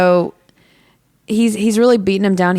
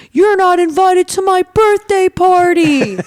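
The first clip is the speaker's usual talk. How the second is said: mock battle cry; 6 dB louder than usual. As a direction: holler it high like a wrestler's big comeback line. Retold wrestler's climactic yell.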